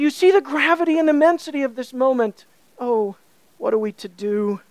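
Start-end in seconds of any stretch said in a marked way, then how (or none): 2.8-4.6 lower, softer, sinking question; hushed despair. Acted. Preacher asking the worshippers what to do, hushed and despairing. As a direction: let the question fall away helplessly.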